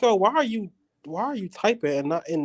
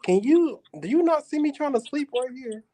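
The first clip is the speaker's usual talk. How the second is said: annoyed complaint; 9 semitones higher than usual. Retold or imitated. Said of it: "I would just be like".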